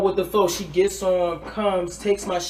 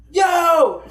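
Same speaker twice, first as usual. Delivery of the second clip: high, loud yelling out in fear; mock panicked scream; 11 semitones higher, 4 dB louder than usual. Scared drunk screaming for homegirl at night, loud high yell. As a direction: holler for help like a scared drunk.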